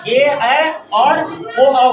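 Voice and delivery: ए, ऐ and ओ each stretched very slow; very slow vowel demo of ए ऐ ओ; drawn-out vowels. Shown as a demonstration. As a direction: stretch out every vowel so they can hear it. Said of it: "इसका देखिए बनता कैसे".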